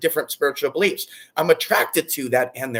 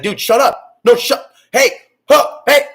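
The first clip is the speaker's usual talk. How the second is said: louder and higher, building to a yell; mock angry shout; 10 semitones higher, 10 dB louder, slower than usual. Imitating an annoyed listener in the same room, building mock shout. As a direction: shout them down, getting louder each word. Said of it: "You're like".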